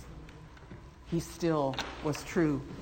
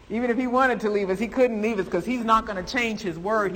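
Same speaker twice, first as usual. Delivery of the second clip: laughing; 5 semitones higher, 5 dB louder than usual. Real, not acted. Laughs while preaching.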